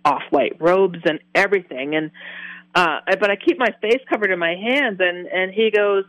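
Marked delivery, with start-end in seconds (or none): none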